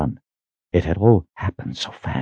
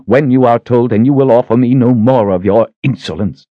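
much higher, louder furious tirade; furious shout; 7 semitones higher, 4 dB louder than usual. Acted. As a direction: shout him down in rage. Sister lashing out at Richard.

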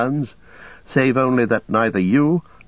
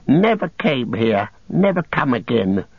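slow, fretful creature whine; Gollum, pleading; 2 semitones higher than usual. Acted. Cowering captive swearing he never came here. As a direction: plead to be let go.

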